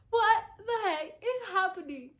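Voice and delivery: high, loud disbelief breaking into a laugh; shocked laughing outcry. Retold or imitated. Reader reacting to the twist, loud laughing shock. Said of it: "and I'm like".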